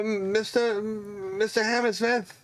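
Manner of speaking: high, slow hesitant call to the master; a shy kid calling his boss; timid apprentice calling. Acted. Apprentice calling the dwarf smith, high and hesitant. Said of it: "He kinda looks".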